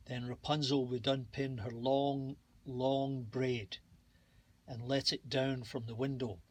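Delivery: usual speaking voice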